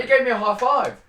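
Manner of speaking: loud, high excited boast; excited shout. Real, not acted. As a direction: shout it out proudly.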